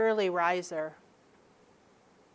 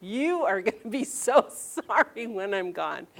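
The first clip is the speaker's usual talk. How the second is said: grandparent guilt trip; 4 dB louder than usual. Retold or imitated. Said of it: "maybe you have some grandparents now are going".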